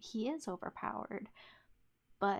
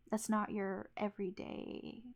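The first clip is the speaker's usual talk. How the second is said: ordinary talk, nothing marked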